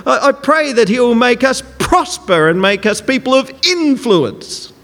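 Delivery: "prosper" and "influence" punched high; exaggerated prayer emphasis. Shown as a demonstration. Preacher parodies technique-driven prayer, overstressing "prosper" and "influence". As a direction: hit "prosper" and "influence" like mock power-praying. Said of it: "add the emphasis at the right place".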